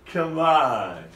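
ordinary talk, nothing marked

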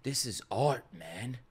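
a slow, soft whisper; hushed, amazed whisper to yourself; awed whisper. Real, not acted. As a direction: breathe it out in awe of the art. Awed by the ending art.

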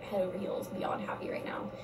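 hushed whisper. Real, not acted. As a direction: breathe it out softly. Gushing about boyfriend.

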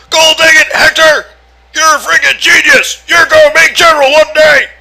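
high, loud drill sergeant yell. Acted. Drill sergeant praising Hector.

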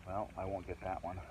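ordinary talk

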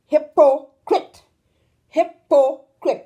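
"Hypocrite" slow and loud, leaping up on PO then dropping on CRITE; up-and-down stress. Shown as a demonstration. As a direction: break up "Hypocrite", punching each syllable up and down. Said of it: "You go up and you come down".

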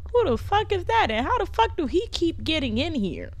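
ordinary talk, nothing marked

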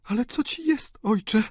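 very high, alarmed question; high female voice full of alarm; horrified daughter. A narrator's reading. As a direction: ask father in rising panic. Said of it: "pytała baronowa przerażona".

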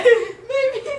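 high, laughing near tears. Real, not acted. Friend asks if okay, laughing reply. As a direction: squeak it out through laughter.

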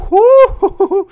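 loud high whoop with laugh; relieved cheer. Real, not acted.

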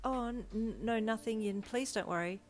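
flustered denial. A narrator's reading. Deku hides a plan from his date, flustered. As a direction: trip over the no, then brush it off. Caught out, stammering to reassure her.

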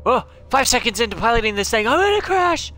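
high, loud, fast panic; panicked yelling. Real, not acted. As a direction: yell out in rising panic as the crash looms. Panics while piloting the submarine.

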